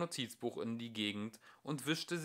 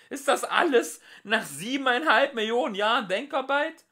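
high and much louder indignant cry; outraged; 11 dB louder than usual. Acted. Lunkual protests to the computer in high outrage. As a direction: howl in disbelief.

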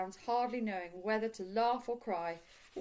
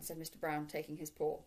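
usual speaking voice

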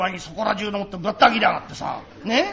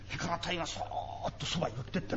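softly, as if creeping up; dropping the voice for the sneaky part; hushed sneaking tale; 4 semitones lower, 14 dB quieter, faster than usual. Acted. Child hushed to father, telling how she crept close.

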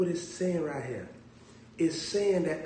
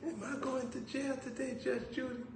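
mock timid old lady; 10 semitones higher, 11 dB quieter than usual. Mock fearful question.

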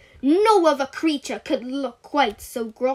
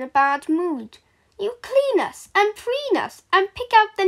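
babies' voice; 5 semitones higher than usual. Acted. Babies speak to their parents.

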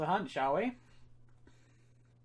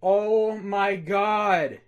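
awed; 3 semitones higher, 11 dB louder, much slower than usual. Real, not acted. Stunned by another shiny.

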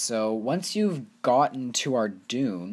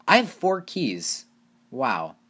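excited; 4 semitones higher, 6 dB louder, slower than usual. Real, not acted. Excited about opening loot keys.

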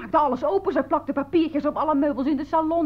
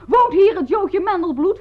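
loud, high mock police bellow; imitated bullying shout; 7 semitones higher, 7 dB louder than usual. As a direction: mimic a puffed-up officer shouting. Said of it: "schreeuwde dat hij van de politie kwam".

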